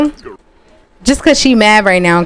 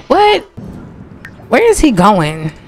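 surprised; 2 semitones higher than usual. Real, not acted. Shocked at game event.

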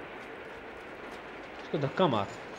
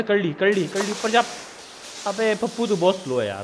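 ordinary delivery

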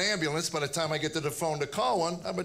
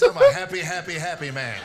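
forced happy; 3 semitones lower, 8 dB louder than usual. Real, not acted. Ironic happy-man refrain.

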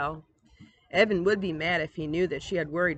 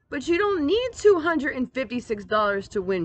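raised, high mock shout; 7 semitones higher, 5 dB louder than usual. Sister shouting at her brother about the money, loud. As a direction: yell the protest at him. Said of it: "she shouted".

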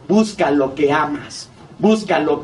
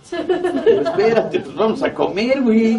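laughing plea; 7 semitones higher than usual. Retold or imitated. Begging son to stay and eat.

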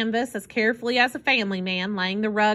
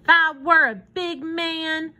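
put-on slow, higher-pitched child voice; small boy; 7 semitones higher, slower than usual. A narrator's reading. Boy wishes he were grown, boyishly indignant. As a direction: declare it as a little boy. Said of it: "said Paul".

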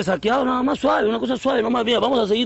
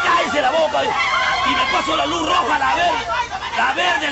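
higher, heated outburst; defiant yelling; 4 semitones higher, slower than usual. Acted. Driver brags to critic about running lights, defiant yelling. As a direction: shout back with open defiance.

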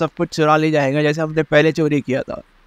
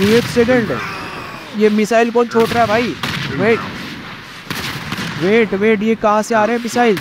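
alarmed; 3 semitones higher than usual. Real, not acted. Gamer spotting incoming missiles, alarmed. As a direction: react in sudden alarm, voice raised in pitch. Missiles suddenly fly in game.

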